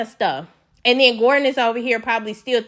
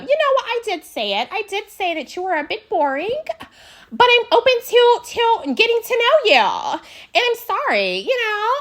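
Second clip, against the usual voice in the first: high apologetic voice; 11 semitones higher than usual. Retold or imitated. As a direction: imitate a timid sorry, pitched up. Quoting Mia's apology.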